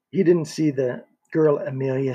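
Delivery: plain reading voice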